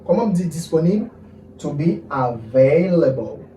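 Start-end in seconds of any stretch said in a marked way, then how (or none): 1.6-3.4 slow, drawn-out teaching delivery; measured language-teacher pace; slow model phrase. Shown as a demonstration. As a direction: spell out each word for learners. Teacher models English phrase slowly.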